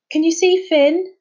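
high, loud call down to the water. Acted. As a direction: call down to Amir. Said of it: "Jazz called back".

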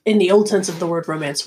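ordinary talk, nothing marked

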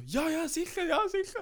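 hammed-up sure-sure; eager saleswoman. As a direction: chirp it like a keen shop lady. Saleswoman's eager reply retold.

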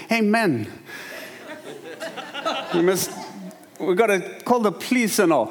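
imitated Indian accent. Comedian friend ribbing a passing driver, mock Indian accent. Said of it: "ripping off the Indian guy in Indian accent".

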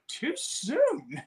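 squeaky mock complaint, kept soft; mock reaction. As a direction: imitate a listener's high little protest. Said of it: "You'd be like".

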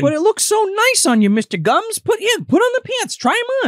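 high, sing-song put-on voice; coaxing Peter voice. Peter coaxing Mr Gums into the pants, high and sweet. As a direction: coax him sweetly, way up high.

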